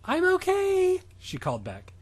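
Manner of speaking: high falsetto schoolgirl; girl voice. Acted. Podcast host voicing Stephanie as a high falsetto girl. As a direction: squeak it out in a silly girly falsetto.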